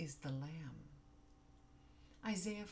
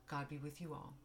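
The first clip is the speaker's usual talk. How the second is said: ordinary talk, nothing marked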